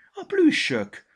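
put-on a child points and shouts at the toys; excited cry. Fruzsi rémülten felkiált. (izgatott, hangos gyerekkiáltás)

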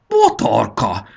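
slow, loud drawn-out wail; howling. A narrator's reading. Dragon recognises his wife as the attacker, howling. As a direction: howl the name, stretching it out. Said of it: "süvöltötte".

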